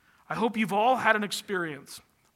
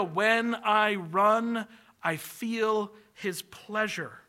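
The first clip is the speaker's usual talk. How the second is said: said very slowly, letting each word land; slow, savoring; 2 semitones higher, much slower than usual. Shown as a demonstration. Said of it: "I love that idea".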